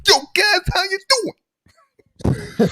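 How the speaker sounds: very high squeaky greeting, drawn out slow at the end; Philly five hundred impression. Host repeats his high YouTuber greeting impression. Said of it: "Just want to do that one thing".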